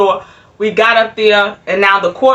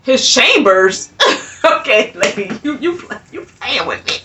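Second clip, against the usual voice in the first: laughing disbelief. Real, not acted.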